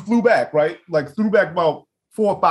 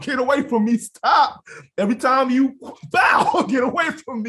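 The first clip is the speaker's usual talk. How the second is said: mock pleading; 6 semitones higher than usual. Mocking the other person's pleading.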